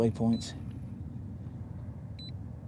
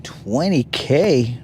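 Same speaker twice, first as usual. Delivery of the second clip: slow, loud disbelieving echo; incredulous; 7 dB louder, much slower than usual. Real, not acted. Fishing streamer scoffing at a chat comment, loud and slow. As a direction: repeat it like you can't believe the number. Reacting to chat donation claim.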